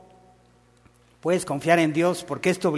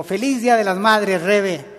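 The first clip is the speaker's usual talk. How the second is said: bright, louder festive greeting; cheerful greeting; 4 semitones higher, 6 dB louder than usual. Retold or imitated. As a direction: greet her warmly with flowers. Esposo saluda con rosas. (saludo alegre del esposo)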